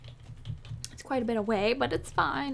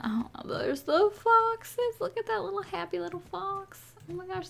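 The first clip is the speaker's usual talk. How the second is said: excited high squeal; 8 semitones higher than usual. Real, not acted. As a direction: coo at the cute fox. Sees foxes, gushes.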